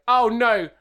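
put-on a tipsy girl brushing him off; lightly played drunk rebuff. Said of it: "a drunk girl who's like".

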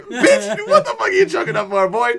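high, loud teasing through laughter; laughing mock taunt. Laughing while mocking crying drunk friend, loud and high. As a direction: rib him while cracking up. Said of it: "I was like".

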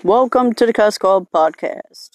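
high, loud welcome to listeners; loud podcast intro. Real, not acted. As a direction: belt out the show's opening.

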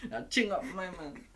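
high, laughing mock menace; hammed-up gangster threat. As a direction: play a tough street threat. Acting out pulling a knife.

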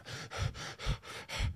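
a thin, shaky unvoiced breath in; shallow shaky breath. Shown as a demonstration. Runner out of breath in the cold, demonstrating a shaky gasp. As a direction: show the struggling breath. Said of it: "shallow breaths".

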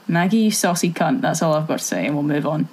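normal talking voice

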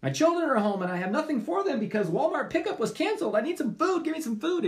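high, loud begging neighbor at the door; pleading neighbor. Acted. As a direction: bang on the door and beg for food.

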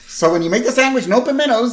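put-on high, insistent kid request; Lisa nagging Mom. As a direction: pester Mom about the sandwich. Acts Lisa nagging Marge about lunch.

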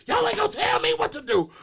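high, loud mock yell; Madea mode. Uncle mimics his sister defying police, high loud yell. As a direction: bellow it like Madea. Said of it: "full blown Madea mode".

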